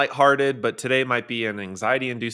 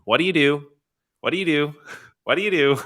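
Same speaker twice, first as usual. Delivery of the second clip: parroting question; 5 semitones higher, 4 dB louder, faster than usual. Retold or imitated. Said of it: "everybody goes around and parrots this question".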